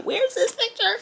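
frantic. Retold or imitated. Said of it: "I'm like".

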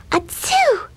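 tiny, very high-pitched mock sneeze; kitten sneeze. A narrator's reading. Custard the kitten interrupts Blueberry, squeaky pretend sneeze. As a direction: squeak out a little acted "achoo". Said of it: "sneezed Custard as she brushed".

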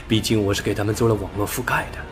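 ordinary delivery

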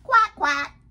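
high, loud duck quacking. Acted. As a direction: squawk it as the duck's motto. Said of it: "as Quack would say".

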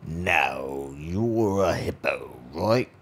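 lightly voiced spider, slow and drawn out; a hint of a curious spider, slow. Spider speaks to hippo.

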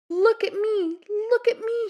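high, loud, showing off; excited cry. A narrator's reading. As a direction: crow it out, bursting with pride. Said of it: "he cried".

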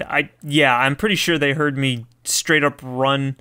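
ordinary delivery

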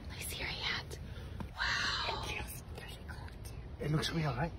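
hushed unvoiced whisper. Real, not acted. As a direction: whisper so he won't hear. Quiet secret prank setup.

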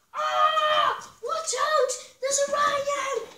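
high, loud panicked yell; alarmed warning shout. Pretend. Pretend danger, warning shout.

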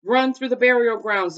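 loud, high urging; urgent warning. A narrator's reading. Scholar tells Jack and Annie to flee, urgent warning. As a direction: send them running for safety.

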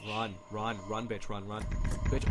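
usual speaking voice

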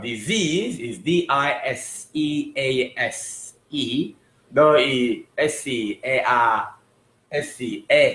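disease spelled letter by letter, slowly; slow spelling. Shown as a demonstration. Teacher spelling disease slowly for students. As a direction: spell each letter out clearly. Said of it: "Sau về đánh vần nha".

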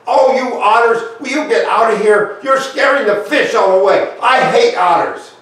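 put-on high, loud, furious child shouting at the otters; angry yell. Little Bear yells at the otters scaring her fish, angry and loud.

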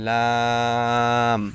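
long, held লাম with tongue edge by upper teeth; slow demonstration of লাম from the tongue's edge; tongue-edge letter. Shown as a demonstration. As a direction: draw লাম out, tongue-tip edge at the tooth roots. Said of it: "জিহ্বার আগার কিনারা".

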